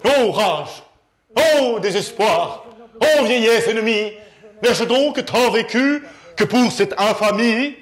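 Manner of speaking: loud, slow, grand Corneille tirade; mock tragic declamation. Comic mocking a monologuist, loud tragic declamation. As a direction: declaim it like a ham tragedian. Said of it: "se met à déclamer".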